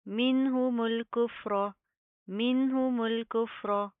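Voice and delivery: slow, drawn-out tajweed chant; Quranic recitation. Shown as a demonstration. Teacher models the verse slowly for students. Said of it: "recitation demo".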